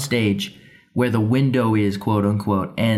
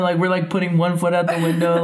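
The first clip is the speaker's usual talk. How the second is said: ordinary talk, nothing marked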